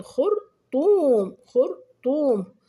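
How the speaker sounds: "خرطوم" slow, the "طو" long, high and loud; stretched waw. Shown as a demonstration. Teacher showing pupils madd al-waw, stretched waw. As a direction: break "خرطوم" up and swell on the second syllable. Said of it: "هذا هو مد الواو".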